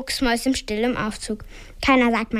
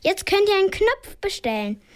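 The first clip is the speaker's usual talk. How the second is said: put-on much higher, bossy child call; loud call; 8 semitones higher than usual. A narrator's reading. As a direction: play the lift girl ordering buttons. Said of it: "ruft Flo ganz laut".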